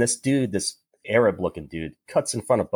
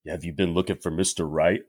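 cheesy pickup line; 5 semitones lower than usual. Retold or imitated. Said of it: "me going up to somebody and say".

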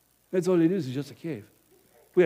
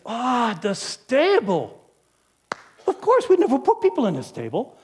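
musing; 6 semitones higher, 4 dB louder than usual. Acted. Mulling the stable idea.

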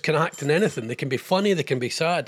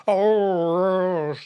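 Jonathan Vernon Smith impression. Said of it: "that's my J V S impression".

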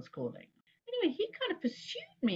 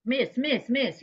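urgent, slightly raised repeated call; imitated hail, louder and higher; calling after someone; 5 semitones higher, 4 dB louder, slower than usual. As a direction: call out to catch someone leaving. Trombonist chasing after her, calling.

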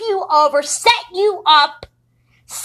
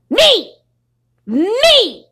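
angry shout; 6 semitones higher than usual. Real, not acted. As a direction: yell it out, furious. Ranting at people who hurt her.

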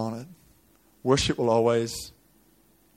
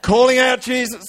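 high, raised preacher's cry; high-pitched dramatic sermon telling; impassioned preaching; 10 semitones higher, 7 dB louder than usual. Acted. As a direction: preach the story at full pitch. Preaches at full force.

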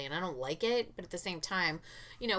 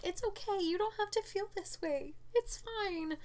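high, soft comforting voice, like cooing to a puppy; soothing a sad child; 13 semitones higher, 4 dB quieter than usual. Retold or imitated. Mother cooing to her crying little boy, high and soft. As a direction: coo reassurance up high. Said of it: "hug them and love them and be like".

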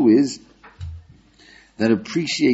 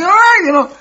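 much higher, louder mock plea; exasperated customer; 12 dB louder than usual. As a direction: play the impatient customer begging for the shoe. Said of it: "You say".

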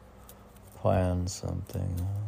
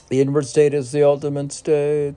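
emphatic recital; 7 semitones higher, 10 dB louder, faster than usual. Acted. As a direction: read it again higher and louder. Rereading poem line louder.

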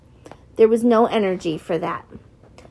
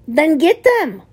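raised, louder impatient command; angry order; 7 semitones higher, 5 dB louder than usual. Acted. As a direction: shout the order, out of patience. Exasperated order to guard.

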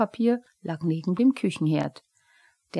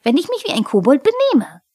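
high, loud kobold interruption; hot-headed squeal; indignant outburst; 9 semitones higher, 9 dB louder, faster than usual. A narrator's reading. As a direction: cut in loudly, high-voiced. Kobold cuts in during quarrel.

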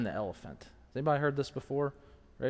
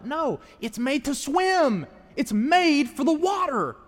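raised, high, slow and drawn-out; emphatic rebuttal; 10 semitones higher, 5 dB louder, slower than usual. Acted. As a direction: cry it out slowly as an emphatic correction. Preacher rebuts an imagined complaint.

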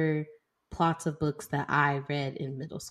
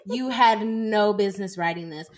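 mock scolding; 4 semitones higher, 5 dB louder than usual.

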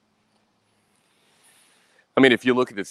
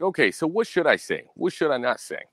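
lightly voiced higher-pitched questioner; imitated eager questioner; 6 semitones higher than usual. Scammer asking his target for advice, lightly imitated. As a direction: raise the pitch a little, as if asking for coaching. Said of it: "He was like".

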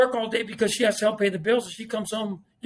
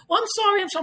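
higher, apologetic nanny voice; imitating nanny; 10 semitones higher, faster than usual. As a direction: pitch it up like a flustered nanny. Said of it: "She goes".

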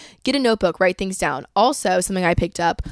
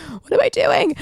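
mock desperate cry; 12 semitones higher, 4 dB louder than usual. Repeated, louder, more desperate.

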